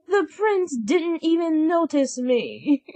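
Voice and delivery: high, whiny complaint; a hammed-up stepsister whimper; mock whine. A narrator's reading. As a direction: sulk like a spoiled girl. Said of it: "cried Anastasia".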